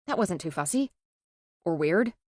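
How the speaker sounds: ordinary delivery, nothing marked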